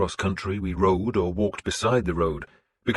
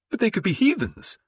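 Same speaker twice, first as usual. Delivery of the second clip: shocked, high and rising; a priest's shocked protest; 3 dB louder than usual. A narrator's reading. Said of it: "He sounded shocked".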